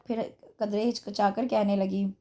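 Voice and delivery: plain reading voice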